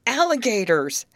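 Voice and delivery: loud, high burst on one word; playful interjection. Acted. Betty finishing Grace's gushing list, playful loud interjection.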